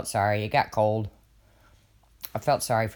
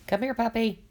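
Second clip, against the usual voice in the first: high sweet call, a little off from own voice; coaxing puppy call; 10 semitones higher than usual. Retold or imitated. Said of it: "you know".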